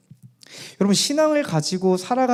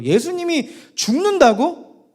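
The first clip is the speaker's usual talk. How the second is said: high, incredulous questioning; can't accept it, acted outburst; stretched first question, then rushed dismay; Peter's disbelief; 5 semitones higher than usual. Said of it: "베드로는 지금 미치겠는 거예요".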